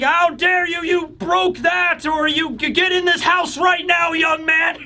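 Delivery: high-pitched scolding mom voice; hammed-up outrage of a mom telling off her son; mock screaming mother. Elbaf mother ordering her son indoors, mock screech. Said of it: "mothers of Elbaf screaming like".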